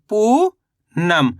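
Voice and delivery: Poonam very slow, पू long and rising, pause, then नम; word split in two. Shown as a demonstration. Teacher reading the finished word to learners, very slowly in two parts. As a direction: break Poonam into पू ... नम. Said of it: "तो मिलके बन गया".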